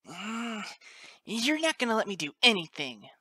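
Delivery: high, loud defiant colt; sudden bark. A narrator's reading. Colt answering back to his father, high angry bark. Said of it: "Snip suddenly barked up at him".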